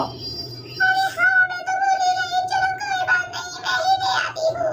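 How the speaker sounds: high, put-on old woman's voice; squeaky elderly saas, grumbling; old mother-in-law. (ऊँची आवाज़ में बूढ़ी सास)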